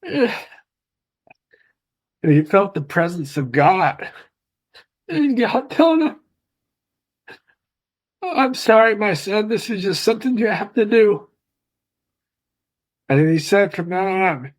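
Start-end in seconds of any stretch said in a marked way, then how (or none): 0.0-11.3 high, strained voice, on the edge of tears; choked up. Real, not acted. Son retelling God comforting his father, choked up. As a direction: hold back the sobs as you speak.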